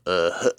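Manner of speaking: staccato, chuckling cartoon laugh; long stuttering "uh-huh-huh" laugh; crazy Butthead laugh. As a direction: imitate Butthead's dopey machine-gun chuckle. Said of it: "that crazy freaking butthead laugh".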